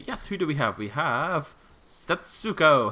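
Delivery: slow, loud, drawn-out name reveal; announcer reveal. Pretend. Player announces the first park visitor, slow and loud. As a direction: stretch the name like a big reveal.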